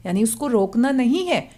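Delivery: ordinary talk, nothing marked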